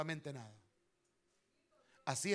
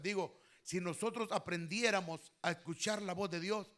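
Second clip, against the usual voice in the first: usual speaking voice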